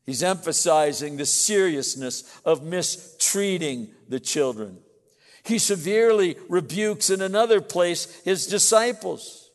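loud, emphatic sermon delivery; forceful preaching. Real, not acted. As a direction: preach it out to the congregation.

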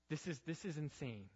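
usual speaking voice

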